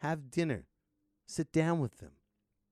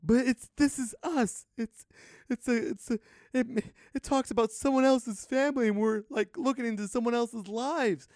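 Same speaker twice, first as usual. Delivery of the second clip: mock gushing fan; 8 semitones higher than usual. Mocking This Is Us fans.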